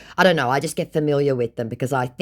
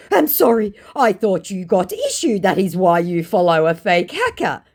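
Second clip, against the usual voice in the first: mock scammer voice; 5 semitones higher, 5 dB louder than usual. Voicing the scammer's reply.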